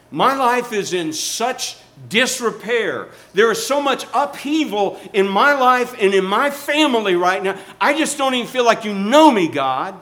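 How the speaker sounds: high, loud preacher crying out as David; impassioned preaching. Acted. Preacher voicing David's complaint to God, impassioned preaching. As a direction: plead with God at full pulpit volume.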